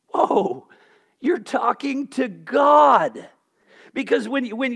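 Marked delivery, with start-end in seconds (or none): 0.1-3.3 a loud, slow, higher shout of rebuke; startled rebuke. Real, not acted. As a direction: hit it hard, shocked at the words. Preacher rebukes the quoted attitude.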